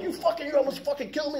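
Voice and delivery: retold flipping out. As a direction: accuse him, outraged and high. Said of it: "I flipped out".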